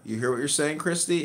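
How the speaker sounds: normal talking voice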